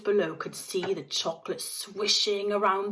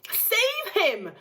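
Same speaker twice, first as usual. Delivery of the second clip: mock scream; 14 semitones higher than usual. A narrator's reading. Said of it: "screamed Missus Gloop".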